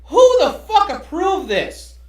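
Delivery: loud, high, slow outraged yell; outraged shout. Real, not acted. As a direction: demand it in disbelief. Outraged at the cartoon.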